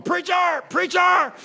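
high, loud holler to stop him; imitated alarmed shout. Jim calls to the preacher banging a head, shouted. Jim calling out during the scuffle.

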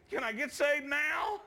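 high-pitched, drawn-out earnest question; imitated girl's plea. Voices the weeping girl's plea.

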